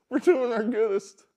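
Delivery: high, loud, laughing repeat of goodest; laughing mockery. Real, not acted. Podcaster mocking the president's quote, laughing high and loud. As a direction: laugh through our goodest. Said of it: "This is the president".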